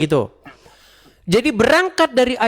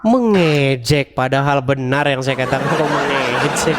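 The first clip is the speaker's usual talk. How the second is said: scoffing disbelief; 7 semitones lower than usual. Real, not acted. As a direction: draw out the accused word, low and amused. Scoffing at accusation of mocking.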